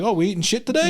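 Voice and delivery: high mock-cheerful comeback; answering a hostile patient, mock cheerful. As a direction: bounce the insult back brightly. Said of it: "it's like".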